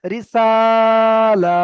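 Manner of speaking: রিসালা with রি short and the সা vowel held long; long drawn vowel. Shown as a demonstration. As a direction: keep রি clipped, stretch the আ of সালা. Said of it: "তো এখানে রিটাকে জুড়ে নেব".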